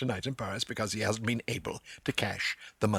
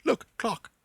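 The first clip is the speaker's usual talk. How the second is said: a raised, much higher, broken English pointing at the clock; pidgin English; 11 semitones higher than usual. Retold or imitated. Stephen coaxing the clerk in pidgin: high, insistent. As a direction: spell it out in simple words to a stubborn clerk. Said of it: "leads the man through pidgin English".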